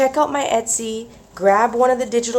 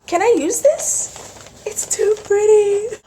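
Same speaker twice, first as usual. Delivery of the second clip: much higher, louder, slow squealing; high excited squeal; 14 semitones higher, 5 dB louder, slower than usual. Real, not acted. Vlogger gushing over rice paper, high slow squeal. Paper too pretty to use.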